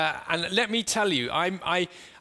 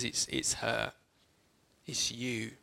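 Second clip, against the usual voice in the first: much lower and softer, gentle; tender recognition; 5 semitones lower, 7 dB quieter, much slower than usual. Acted. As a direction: speak straight to her. Jesus singles out the woman.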